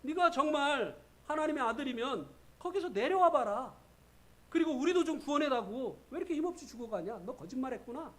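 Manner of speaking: the thief's loud, high mockery; mocking taunt. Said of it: "조롱했잖아요".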